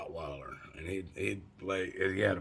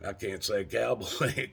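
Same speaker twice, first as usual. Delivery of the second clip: ordinary talk, nothing marked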